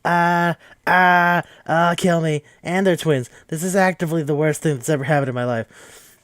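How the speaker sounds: high-pitched mock horror, grossed out; hammed-up wailing freak-out. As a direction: play a sibling utterly disgusted and panicking. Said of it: "It's just freaking out".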